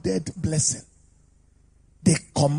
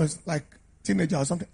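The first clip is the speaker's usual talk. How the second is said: ordinary delivery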